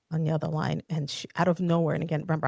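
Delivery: ordinary talk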